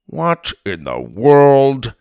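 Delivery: slow, drawn-out disbelief; astonished. A narrator's reading. Grandpa finding the elephant behind him, astonished.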